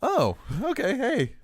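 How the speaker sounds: high-pitched imitation of a caught-off-guard reply; acted reaction. Cop replying to the hooker reminder, high-pitched mock reaction. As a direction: play his reaction, voice jumping up. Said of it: "He goes".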